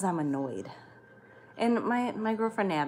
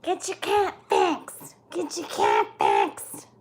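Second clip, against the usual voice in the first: mocking nagging people; 10 semitones higher, 6 dB louder, much slower than usual. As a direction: parody everyone nagging her, slowly. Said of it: "Everybody yells at me".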